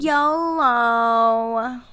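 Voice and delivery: drawn-out yolo; mock hype. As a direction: say it like a dated slogan. Mock old slang.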